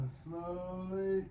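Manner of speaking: long, stretched "slowly"; slow exercise cue. Real, not acted. Exerciser paces the third pull, drawn out. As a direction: draw the word out with the pull.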